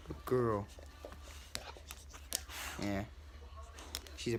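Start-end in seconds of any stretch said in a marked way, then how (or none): none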